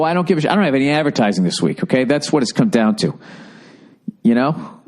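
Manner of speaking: ordinary talk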